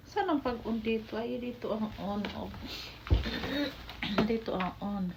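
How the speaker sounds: ordinary delivery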